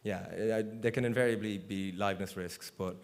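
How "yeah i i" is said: ordinary delivery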